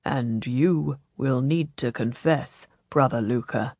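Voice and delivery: low, slow monk's admonition; gentle. A narrator's reading. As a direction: chide gently, pitched well down. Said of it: "Brother Peter said gently".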